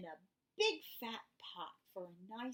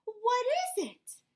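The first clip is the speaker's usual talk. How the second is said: eager question; 14 semitones higher, 11 dB louder than usual. Acted. Amu asks eagerly.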